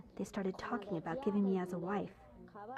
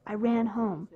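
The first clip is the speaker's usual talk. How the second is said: normal talking voice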